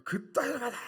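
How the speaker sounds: imitating a furious superior's shout; loud, high-pitched mock reprimand; angry yelling. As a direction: bawl it like an irate army superior. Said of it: "소리를 막, 막 지르면서".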